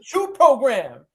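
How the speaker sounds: slow, high, loud Denzel Training Day command. Retold or imitated. Ordering a lockdown, slow Denzel-style command. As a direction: drag out the order like a crooked cop. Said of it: "I turned into Denzel on, uh, Training Day".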